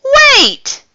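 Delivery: high, loud urgent call. A narrator's reading. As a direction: shout after someone walking away. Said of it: "called O'Malley".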